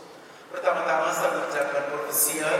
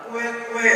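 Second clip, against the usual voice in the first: high, even quack from afar; imitated duck quack; 6 semitones higher than usual. Preacher mimics a sound heard from afar, imitated quacking. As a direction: imitate a distant duck, higher pitched. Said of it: "Dengar lagi dari kejauhan".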